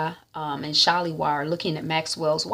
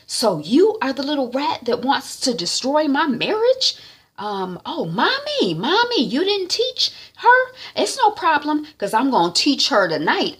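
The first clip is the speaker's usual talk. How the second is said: threatening wife; 7 semitones higher than usual. Retold or imitated. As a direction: scold the mother and threaten the girl. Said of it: "Wifey is like".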